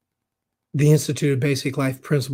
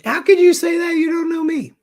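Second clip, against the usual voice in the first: higher, louder, incredulous protest; mock indignant listener; 7 dB louder than usual. Host voicing a doubtful listener, mock indignant. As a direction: play a hurt listener pushing back. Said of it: "And if you're saying".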